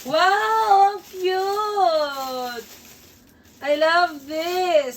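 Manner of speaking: high, loud, drawn-out gushing; squealing with real delight; excited delight. Real, not acted. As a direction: gush loudly over a lovely gift. Delighted reveal of the bag.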